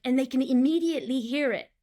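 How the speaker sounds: normal talking voice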